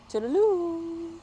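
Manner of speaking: high, slow sing-song farewell; playful goodbye. Real, not acted. Storyteller waving goodbye to the kids, high and sing-song. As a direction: sing it out like a cheery wave. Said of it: "playful goodbye to viewers".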